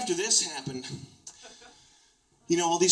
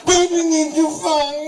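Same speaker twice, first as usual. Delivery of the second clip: put-on higher, pushier baby-talk with stress on baby; stronger baby voice; 13 semitones higher, 9 dB louder than usual. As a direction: lean harder on baby. Repeated begging in baby voice.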